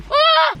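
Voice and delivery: sudden high yelp of shock; quick startled shriek. Real, not acted. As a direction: yelp out in surprise. Sudden fright while driving.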